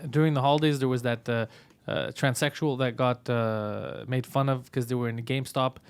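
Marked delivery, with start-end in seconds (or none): none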